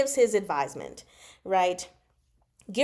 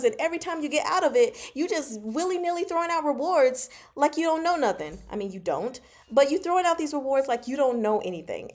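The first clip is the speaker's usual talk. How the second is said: exasperated bro talk; 6 semitones higher than usual. Retold or imitated. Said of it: "He's like".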